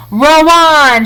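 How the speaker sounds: high, loud cry to the rowers; rally the rowers with a shout; shouted order. A narrator's reading. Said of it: "shouted Mister Wonka".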